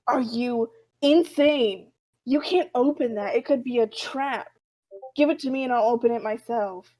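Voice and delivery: loud, incredulous outburst; alarmed scolding. Retold or imitated. Thompson warns the doctor off the parcel, alarmed scolding. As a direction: scold with forceful alarm, stretching the opening.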